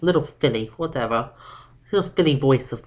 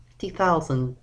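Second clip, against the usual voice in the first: ordinary delivery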